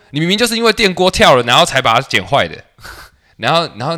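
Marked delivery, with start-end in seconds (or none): 0.1-2.6 higher and louder, a retold angry protest; angry retort. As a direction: snap back at the barber, indignant. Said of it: "然后我生气，我说".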